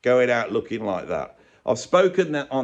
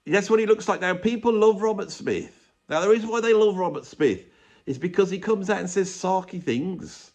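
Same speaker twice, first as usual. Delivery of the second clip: ordinary delivery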